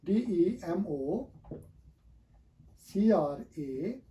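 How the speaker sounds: ordinary delivery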